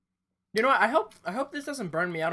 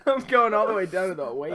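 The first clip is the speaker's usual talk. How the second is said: laughing; 6 dB louder than usual. Real, not acted. Laughing while declaring.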